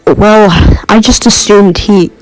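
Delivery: ordinary delivery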